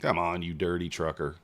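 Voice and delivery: ordinary talk, nothing marked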